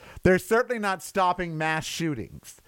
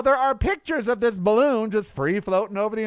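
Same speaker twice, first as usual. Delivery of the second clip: ordinary talk, nothing marked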